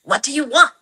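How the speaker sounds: imitating an overwhelmed mother's impulsive reply; overwhelmed snap-back. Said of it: "upsetting and kinda overwhelmed".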